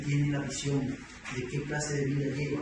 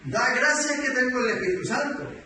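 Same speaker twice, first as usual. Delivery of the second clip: higher, louder mock threat; imitated indignation; 7 semitones higher, 5 dB louder than usual. Offended sister to man who stepped on her foot, imitated indignation. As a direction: act out the offended sister, voice raised.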